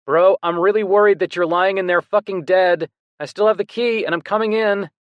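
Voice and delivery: loud, high worried call; worried shout. A narrator's reading. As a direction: shout through the door in worry. Worried brother calling through door.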